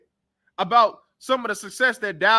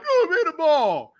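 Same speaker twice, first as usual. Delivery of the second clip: higher, slow whiny demand; mock crying whine; 6 semitones higher, slower than usual. Mocking a receiver crying for the ball, whiny. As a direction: imitate a pouting receiver begging for the ball. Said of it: "He crying like".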